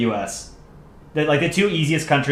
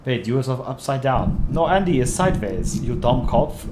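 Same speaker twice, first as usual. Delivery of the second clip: mock German accent; 3 dB quieter than usual. Comic characters bickering over upside down, mock German accent.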